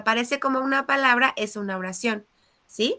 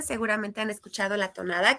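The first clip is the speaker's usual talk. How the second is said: normal talking voice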